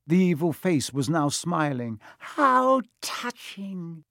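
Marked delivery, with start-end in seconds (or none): 2.2-4.1 high, very slow villain sneer; hissed. A narrator's reading. The face mocks Harry's outburst, hissing. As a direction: hiss it out high and drawn-out. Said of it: "it hissed".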